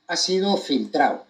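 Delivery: ordinary delivery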